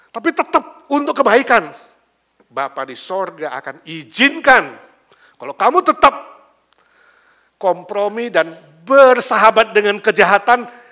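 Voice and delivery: loud, high-pitched sermon warning; full-force preaching. Real, not acted. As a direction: thunder the warning to the congregation.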